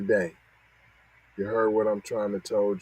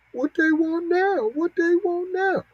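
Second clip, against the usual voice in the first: much higher put-on voice, teasing; mocking voice. Host mimicking the politicians to viewers, high mock voice. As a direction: squeak it out like a taunting kid. Mocking what they really want.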